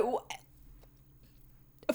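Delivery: ordinary delivery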